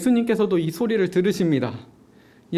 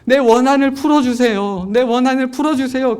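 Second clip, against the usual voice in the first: loud, high repeated plea; pleading; 5 semitones higher, 6 dB louder than usual. Retold or imitated. Widow pleading with the judge, loud insistent begging. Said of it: "계속 간청하니까".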